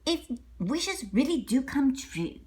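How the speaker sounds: usual speaking voice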